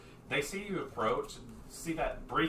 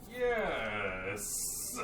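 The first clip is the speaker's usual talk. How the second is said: long, drawn-out hesitant yes; stretched-out yes. Acted. Barrington answers request to look at bodies, stretched out. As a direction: draw out "yes" reluctantly. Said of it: "Barrington kinda stretch out this yes".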